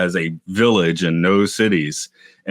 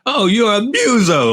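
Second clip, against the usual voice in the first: put-on Australian accent; 3 dB louder, slower than usual. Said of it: "their friend in an Australian accent".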